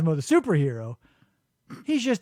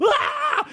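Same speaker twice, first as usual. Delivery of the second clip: high startled yelp, mock shock; retold yelp. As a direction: squeal it out like you just got zapped.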